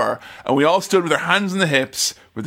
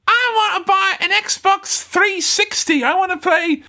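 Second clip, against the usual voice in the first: hammed-up high-pitched demand; demanding customer. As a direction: whine it way up high like a spoilt customer. Acting out crash-era consumer demands.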